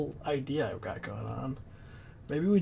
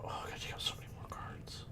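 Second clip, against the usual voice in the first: whispering; 14 dB quieter, faster than usual. Real, not acted. Whispered to self.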